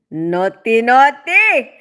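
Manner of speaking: loud teasing naughty, naughty, leaping up on the second; playful echo. Pretend. Streamer echoing viewer's role play comment, playfully teasing and loud. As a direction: say "Naughty, naughty" as a punchy, playful tease.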